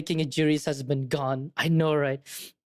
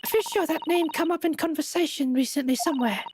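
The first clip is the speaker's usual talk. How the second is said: much higher, polite put-on voice; high companion voice; 9 semitones higher than usual. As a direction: muse politely in a high character voice. Voicing the female companion character.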